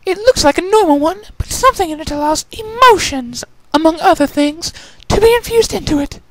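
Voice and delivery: very high, elegant lady voice; refined lady. Acted. Rarity explaining to Applejack, high and refined. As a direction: explain with genteel flair.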